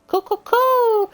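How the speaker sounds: high, slow cooing like a pigeon; cooing bird laugh. Retold or imitated. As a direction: coo it out high and drawn. Imitating bird laugh sound.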